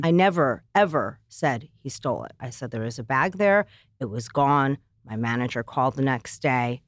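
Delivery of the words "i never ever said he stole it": never and ever hit hard; emphatic never, ever; stress on never ever. Real, not acted. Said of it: "let me make this very clear".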